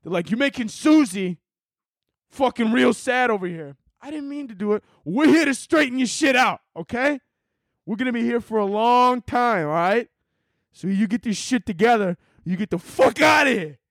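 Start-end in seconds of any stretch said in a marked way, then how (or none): 0.3-3.8 high-pitched wiseguy accusation; Italian mobster. Acted. Mobster accusing sadness, hammed-up indignation. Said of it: "big-ass Italian mobsters". 4.0-4.8 tiny high voice, softer; meek plea. Acted. Sadness excusing itself to mobsters, meek plea. 5.0-13.8 high, forceful wiseguy lecture; Italian mobster. Acted. Mobsters ordering sadness out, hammed-up menace. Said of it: "big-ass Italian mobsters".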